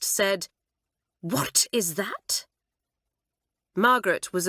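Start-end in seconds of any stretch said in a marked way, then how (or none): 1.2-2.5 disgusted. A narrator's reading. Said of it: "with an expression of disgust".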